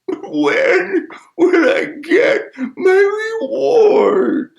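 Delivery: very high, very slow crying wail; hammed-up sad wail. Shown as a demonstration. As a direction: sob it out theatrically. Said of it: "Let's say it like we're sad".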